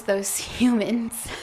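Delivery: soft, slow, laughing it off; nervous laugh. Acted. Suspect to interrogator, nervous laughing cover. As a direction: chuckle guiltily through the words.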